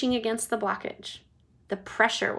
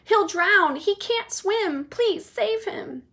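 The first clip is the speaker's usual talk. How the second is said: high, slowing panicked wail; mother wailing high and slow; 10 semitones higher, 3 dB louder, slower than usual. Acted. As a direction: plead desperately. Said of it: "screamed Missus Gloop".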